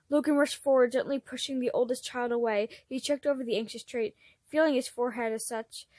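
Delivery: ordinary delivery, nothing marked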